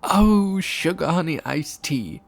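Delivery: loud, high outburst; loud, high character exclamation; cutting her off. A narrator's reading. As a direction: burst in loudly, starting high. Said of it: "cut her off with".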